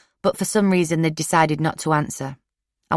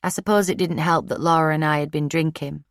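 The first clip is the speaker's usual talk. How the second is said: ordinary delivery, nothing marked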